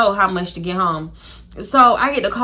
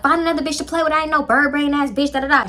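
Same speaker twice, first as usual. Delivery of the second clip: higher-pitched mock telling-off; going off; 5 semitones higher than usual. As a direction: imitate a woman going off on a man. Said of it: "she went off on him".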